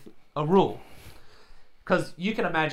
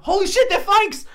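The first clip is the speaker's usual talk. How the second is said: much higher and louder, "Holy shit" blurted at full volume; shocked yell; 9 dB louder than usual. Retold or imitated. Realising the sneakers are fakes, loud shocked yell. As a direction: explode with outrage at the discovery. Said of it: "I, like, yelled out to him".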